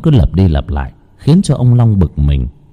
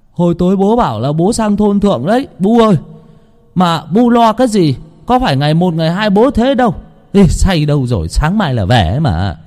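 a hint of a young son answering higher; a son calling back, brushing it off; lightly voiced son; 9 semitones higher than usual. A narrator's reading. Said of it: "nói vọng ra". (giọng con trai đáp vọng ra, nhẹ nhàng)

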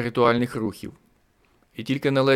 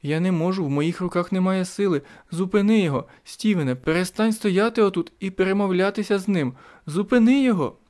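much higher, rising frantic plea; panicked pleading; 9 semitones higher than usual. Acted. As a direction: beg him to stop it, near tears. Diana sobbing, begging.

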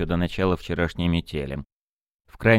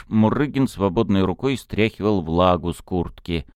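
usual speaking voice

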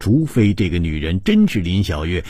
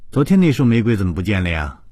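pointed question; 5 semitones higher, 6 dB louder, faster than usual. A narrator's reading. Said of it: "故意说".

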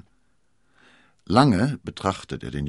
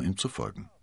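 ordinary delivery, nothing marked

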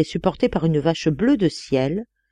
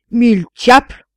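loud, raised-pitch curse; grumbled oath; 6 semitones higher, 9 dB louder than usual. A narrator's reading. Elector objecting to the narrator's success, loud grumbling curse. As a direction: throw it out as an angry oath. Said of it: "grogna l'électeur de Budenhuff".

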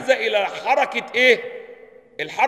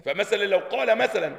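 normal talking voice

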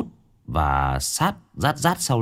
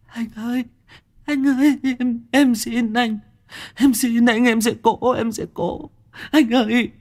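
high, pleading voice begging for mercy; 14 semitones higher than usual. A narrator's reading. Fat boy begging the thug to spare him, high terrified pleading. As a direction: cry out for mercy, voice pitched high.

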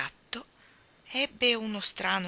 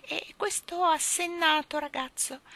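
sly cat; 10 semitones higher than usual. Acted. Cat lists its new servants.

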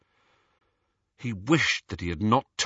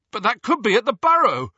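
much higher, louder and fast; loudly; 13 semitones higher, 6 dB louder, faster than usual. A narrator's reading. Ron fearing for his family at the Burrow, loud and fast. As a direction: blurt it out in alarm. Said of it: "said Ron loudly".

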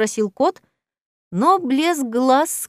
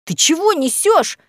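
stunned hiss; 7 semitones higher, 3 dB louder than usual. A narrator's reading. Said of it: "зашипел ошарашенный профессор".

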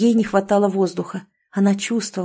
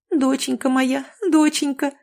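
sobbing; 6 semitones higher than usual. A narrator's reading. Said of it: "всхлипнула матушка".

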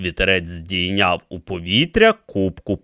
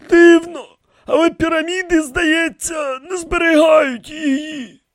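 very high, musing character voice; softened old priest; 3 dB louder than usual. A narrator's reading. High priest searches his memory for family, slightly softened. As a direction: reminisce haltingly, easing off the harshness. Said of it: "голосом дещо м'якішим від свого звичайного".

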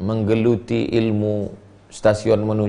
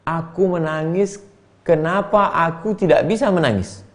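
moved reply; 6 semitones higher than usual. Acted. Weeping pilgrim answers his friends, high and moved.

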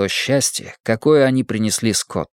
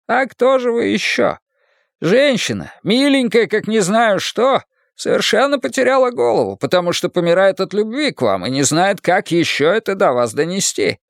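put-on high, lively old man scolding; Leo's voice; 9 semitones higher than usual. A narrator's reading. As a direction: raise the pitch and chide him fondly. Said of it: "Leo lectures Marcus".

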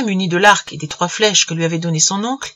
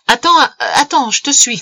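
raised, higher-pitched call; 6 semitones higher, 5 dB louder than usual. A narrator's reading. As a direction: call after someone to wait. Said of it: "cria l'oncle". (crier pour appeler)

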